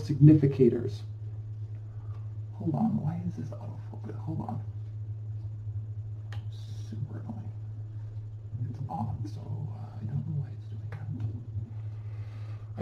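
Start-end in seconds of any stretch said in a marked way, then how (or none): 2.5-12.8 very soft, half-whispered grumble to oneself; muttered aside. Real, not acted. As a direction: mutter under your breath, mildly annoyed. Aside fussing with camera autofocus.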